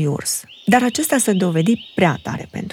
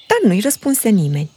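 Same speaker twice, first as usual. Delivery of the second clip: ordinary delivery, nothing marked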